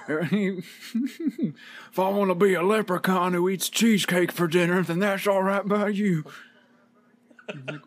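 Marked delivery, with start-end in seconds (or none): 0.1-6.2 mock-serious promo voice with laughter; mocking parody of the promo. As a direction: parody the line with a giggle.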